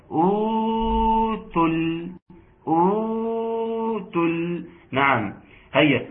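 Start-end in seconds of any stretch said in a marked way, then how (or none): 0.1-4.7 higher "أوتل", long held "uu" then short "tul"; lengthened first vowel. Shown as a demonstration. Teacher demonstrates madd to students, long high "uu" in "أوتل". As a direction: sustain the opening vowel of "أوتل", then close quickly on the l. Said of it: "الأولى تمد بمقدار حركتين".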